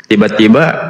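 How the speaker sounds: ordinary talk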